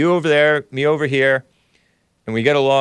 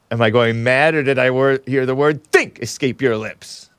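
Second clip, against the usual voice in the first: a hint of the Sicilian villain; lightly quoting the incredulous Sicilian. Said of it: "The clip of me saying".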